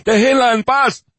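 high, loud desperate yell; shouting. Acted. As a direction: scream at them to let her go. Husband shouts at captors.

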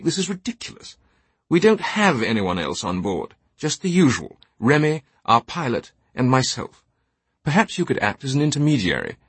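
high, protesting English gentleman; exasperated jet owner. Acted. Teabing appeals to Simon to search the plane, exasperated. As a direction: appeal to a friend against the absurdity.

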